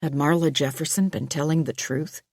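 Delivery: ordinary delivery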